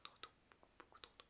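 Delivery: barely audible whispered aside; whispered dread. Real, not acted. Vlogger thinking of tomorrow's early start, in a faint whisper. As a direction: trail into a whisper, as if to yourself.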